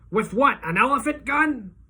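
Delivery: a cop cracking a joke over the struggle; joking cop. A narrator's reading. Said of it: "this one cop says".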